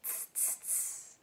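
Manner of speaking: soft unvoiced tss; monkey tsk. A narrator's reading. Monkeys mocking the peddler, soft unvoiced tss. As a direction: hiss it back like a cheeky monkey. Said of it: "and said".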